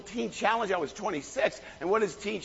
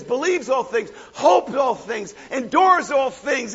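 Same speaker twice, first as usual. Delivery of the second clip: ringing preacher delivery, louder, phrase by phrase; preaching scripture; 4 dB louder than usual. Acted. Preacher proclaiming Corinthians to the church, full-force preaching. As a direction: proclaim each line to the congregation.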